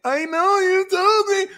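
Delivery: very high, loud whiny wail; hammed-up tearful admission; mock sobbing. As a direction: blubber it in a squeaky put-on voice. Acting the crying friend's reply.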